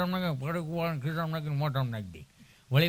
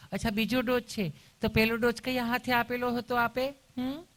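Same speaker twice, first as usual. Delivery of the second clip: higher-pitched, cooing nurse voice; sweetly caring nurse; 5 semitones higher than usual. Acted. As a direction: mimic an over-sweet nurse. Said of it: "તમે કેટલું પ્રેમથી પૂછો છો".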